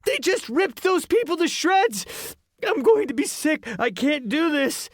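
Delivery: put-on high, loud squeaky boy near tears; a boy about to be sick with fear; panicking kid. A narrator's reading. Ian panicking.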